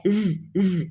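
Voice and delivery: ز hissed softly, buzzing; isolated ز sound; whistling z. Shown as a demonstration. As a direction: make ز whistle. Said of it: "زا میں سیٹی ہوتی ہے".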